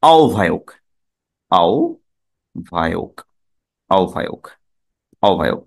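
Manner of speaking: আহ্বায়ক said as ao-vha-ok; ao-vhayok, no h, falling; correct pronunciation. Shown as a demonstration. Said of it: "এটার উচ্চারণ হলো".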